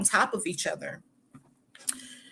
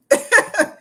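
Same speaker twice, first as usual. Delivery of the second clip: laugh; 13 semitones higher, 8 dB louder than usual. Real, not acted. Laughs at boyfriend correcting her.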